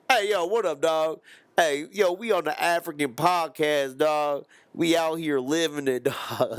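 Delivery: loud high street slang; street guy voice. Pretend. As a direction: hype street guy shouting.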